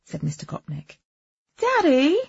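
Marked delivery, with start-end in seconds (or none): 1.6-2.2 high, loud daughter's protest; indignant protest. A narrator's reading. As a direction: cry out to your father in protest. Daughter protests to her father.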